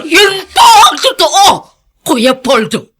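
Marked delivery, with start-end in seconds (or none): 0.1-1.4 high, loud pleading insistence; insistent shout. Acted. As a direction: force him to believe it. Servant insists on the truth. (pasigaw na pagpupumilit)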